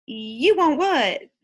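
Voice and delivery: slow, loud "You want what?"; puzzled shop lady, slow and loud; drawn-out disbelief. Acted. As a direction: lean in and drag it out, baffled. Lady leans in, can't hear.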